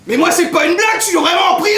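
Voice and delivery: shouting in outrage; outraged shout. Acted. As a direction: yell it out, indignant. Father protesting from prison.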